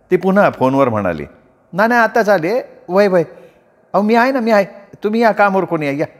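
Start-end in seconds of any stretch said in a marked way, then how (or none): 1.7-6.1 higher rustic wife's voice on the phone; village wife on phone. Acted. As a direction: talk up brightly like a country housewife. Wife reassures husband on phone. (फोनवर बोलणारी गावरान बायको, उंच आवाज)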